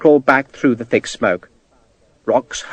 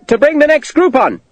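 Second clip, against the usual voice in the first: officer answering the captain; shouted reply; 12 semitones higher than usual. A narrator's reading. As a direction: shout back over the distance. Shouted reply across the field.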